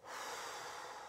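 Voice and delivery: long, soft breath out; releasing sigh. Shown as a demonstration. Host demonstrating letting go, a long sigh. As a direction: exhale slowly to let it all go.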